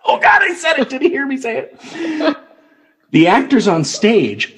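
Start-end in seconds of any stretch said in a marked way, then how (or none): 0.1-2.4 mock panic. Pretend panicked spectator.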